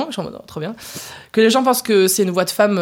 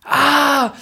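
long, loud, high "ah"; drawn-out exclamation; 7 semitones higher, 5 dB louder than usual. Real, not acted. Singer reacting to the host's spicy question, drawn-out exclamation. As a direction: let out a big high "Ah!".

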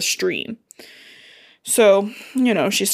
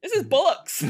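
high, laughing mock British; bad British accent; 7 semitones higher than usual. Host trying once more at a British accent. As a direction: attempt British slang while cracking up. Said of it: "I can't do a British accent very well".

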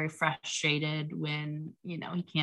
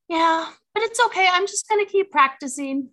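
put-on high, loud little-boy voice; four-year-old son; 13 semitones higher, 9 dB louder than usual. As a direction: imitate a small child piping up bravely. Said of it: "my little boy".

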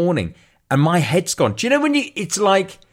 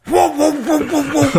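high yelping whoa whoa; imitating barking dog; 11 semitones higher than usual. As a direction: bark it like an excited dog. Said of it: "kicks right off".

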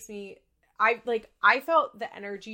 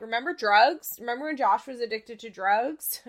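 louder, pointed reminder like a know-it-all; nagging gossip; 3 semitones higher, 8 dB louder than usual. Pretend. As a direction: play the person who keeps bringing up the drugs. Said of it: "this person that's like".

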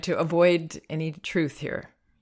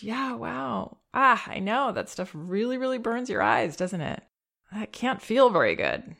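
empathizing; 7 semitones higher than usual. Shown as a demonstration. Parent empathizing with baby after drops, higher and gentle. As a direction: truly feel the sting with him. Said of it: "we should ideally be able to acknowledge".

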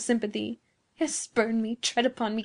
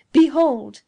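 loud, higher exclamation; sudden forceful shout; commanding cry; 4 semitones higher, 12 dB louder than usual. A narrator's reading. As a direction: burst out in bitter triumph. Giovanni's scornful, scowling demonstration.

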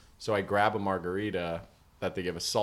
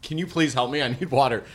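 mock urgent plea; 3 semitones higher, 4 dB louder than usual. Imagined plea to the bartender, mock urgent. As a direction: plead as if it were urgent. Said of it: "Otherwise, I would've been like".

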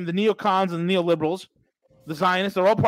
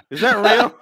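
laughing; 3 semitones higher, 6 dB louder than usual. Real, not acted. Laughing at the clip.

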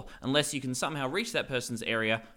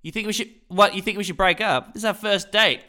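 mock outrage; 6 semitones higher, 7 dB louder than usual. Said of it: "I'll play Tom".

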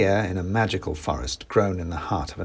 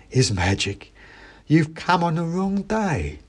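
mouse seller. Acted. Mouse voiced.

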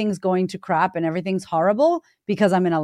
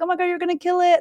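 mock cheering friends; 10 semitones higher, faster than usual. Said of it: "everyone's like".

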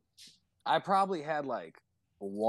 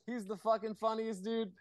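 much higher, hyped-up imitation of friends; mock excited cheering; 12 semitones higher than usual. Friends welcoming back their drinking buddy, imitated excited cheering. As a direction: voice their delight way up high.

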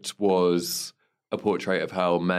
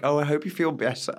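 high, put-on bad English accent; imitating a bad English accent, lightly hammed up; mock terrible English accent; 7 semitones higher than usual. Mocking the actress's bad English accent on the show. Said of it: "the terrible English accent from that woman".

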